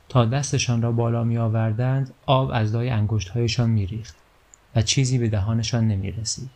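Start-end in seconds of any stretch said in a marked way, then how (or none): none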